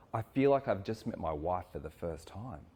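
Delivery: ordinary delivery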